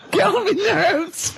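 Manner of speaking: high, slow laughing echo; laughing mimic. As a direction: laugh while parroting his words. Mocking Karl's phrase, laughing.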